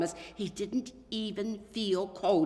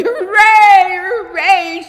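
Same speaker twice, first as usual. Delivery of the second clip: high, much louder cheering; joyful shout; 25 dB louder, much slower than usual. A narrator's reading. Boy cheering at the news of his gift, shouting. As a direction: cheer it out at the top of your lungs. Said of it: "shouted Micah".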